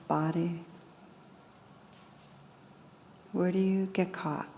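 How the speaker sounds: calm, unhurried guidance with long pauses; guided meditation. Real, not acted. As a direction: gently lead listeners inward, leaving space after each phrase.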